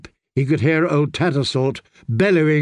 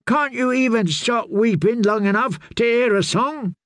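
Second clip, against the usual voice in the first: much higher, scornful outburst; angry snap; 10 semitones higher than usual. A narrator's reading. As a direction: lash out at the weeping girl. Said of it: "Darian snapped".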